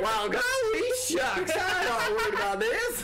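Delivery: very high, hammed-up southern accent. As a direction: squeal it in a cartoon southern voice. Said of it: "When did she get a southern accent?".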